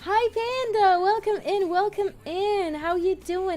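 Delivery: high-pitched, delighted welcome; excited greeting. Real, not acted. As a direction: greet a viewer in a high, happy voice. Streamer greets arriving viewer.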